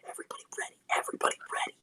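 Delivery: hushed, breathy rally call; whisper. Pretend. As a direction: whisper orders to the squad.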